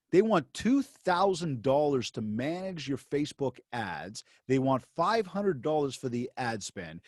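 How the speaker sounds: spelled out slowly; slow, deliberate. Retold or imitated. Repeating the client's deal back slowly. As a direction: lay it out slowly, word by word.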